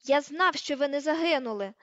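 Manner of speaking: loud, forceful shout; defensive yell; bellowed retort. A narrator's reading. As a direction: outshout the other person. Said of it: "загорлав Рон".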